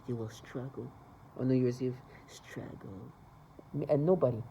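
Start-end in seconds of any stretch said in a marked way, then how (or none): 0.1-0.9 much softer, lowered; hushed mock taunt. Recalling the hallway taunt, hushed. 2.3-3.1 very soft, lowered, rising at the end; near-whispered taunt. Retold or imitated. Mocking the New Year's Eve taunt, near whisper.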